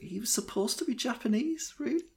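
high, rising disbelief; incredulous. Real, not acted. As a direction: ask it as if you can't believe it. Incredulous doubt about the casting.

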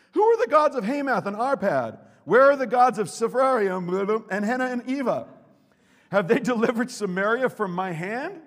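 loud, high defiant reading of the taunt; Assyrian king's taunt read aloud. Acted. Preacher reading the Assyrian king's taunt, booming. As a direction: hurl the challenge.

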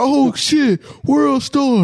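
high excited bystander yell; hyped bystander. Retold or imitated. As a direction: shout it like you're filming a fight. Voicing the filming bystander.

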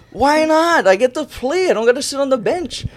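bursting to play; thrilled answer. Retold or imitated. Freshman telling his mom he gets to play, high and thrilled.

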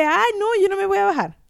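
una negativa cansada y quejumbrosa, un poco más aguda; tired refusal. Retold or imitated. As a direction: quejarse de no querer bajarse del carro, un poco más agudo. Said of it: "Y yo estaba cansada y le dije". (hija cansada que le dice a la mamá que no se baja, en tono quejumbroso)

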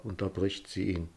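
ordinary delivery

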